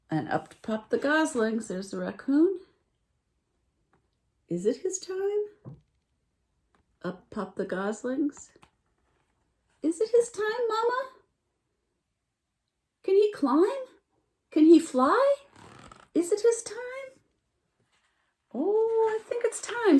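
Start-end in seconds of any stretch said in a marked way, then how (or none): 4.5-5.8 very high, slow question; gosling voice. A narrator's reading. Goslings asking their mama about the deer, high. 9.8-16.8 very high, loud peeping questions; eager goslings. A narrator's reading. Goslings pestering their mama, very high and eager. 18.5-20.0 very high, loud bird voice; blackbird voice. A narrator's reading. Blackbird answering the goslings, very high. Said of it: "said the blackbird".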